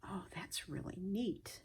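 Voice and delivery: soft, intimate whisper. Real, not acted. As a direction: whisper as if touching silk. Whispering while touching fabric.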